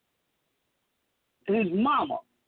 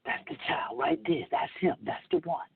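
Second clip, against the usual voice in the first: softer, hushed gossip; hushed whisper; 9 semitones lower, 5 dB quieter than usual. Retold or imitated. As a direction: murmur it, staring at him. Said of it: "looking and whispering".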